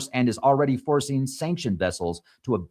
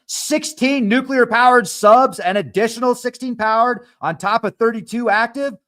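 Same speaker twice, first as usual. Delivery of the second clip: much higher and louder, slowed, amazed at the numbers; much higher and louder, stunned disbelief; excited; 12 semitones higher, 7 dB louder than usual. Real, not acted. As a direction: hammer home the big numbers with real excitement. Reading startling submarine numbers.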